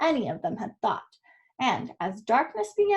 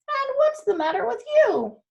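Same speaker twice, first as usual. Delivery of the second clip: much higher, bouncy donkey question; 12 semitones higher, 4 dB louder than usual. Acted. Donkey asks the runaway cat what's wrong, high and kindly. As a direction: ask like a kindly old donkey.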